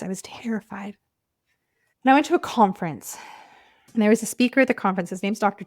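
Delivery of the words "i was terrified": breathy whisper, barely voiced; whispered confession. Real, not acted. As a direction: she whispers to her viewers how scared she was, whispering. Repeats terrified, then whispers it.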